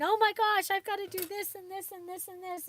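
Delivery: retelling her own afternoon panic, mock frantic. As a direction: play yourself frantic over the clock. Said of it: "and I'm, uh, freaking out".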